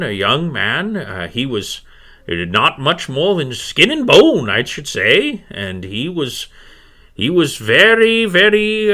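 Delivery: high, swooping crone voice; wavering-pitched old crone gathering her thoughts; old healer woman, pondering. Acted.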